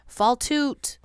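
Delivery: loud shout to start the race; yelled. A narrator's reading. La Petite yelling to crews to start race, loud. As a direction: yell it out across the canoes. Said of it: "La Petite yelled".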